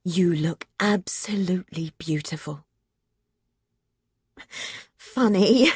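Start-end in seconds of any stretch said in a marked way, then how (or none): 0.0-2.6 whisper. Acted. Will tender compliment.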